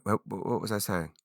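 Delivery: quiet self-muttering. Real, not acted. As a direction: mumble off to yourself. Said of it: "what was my point".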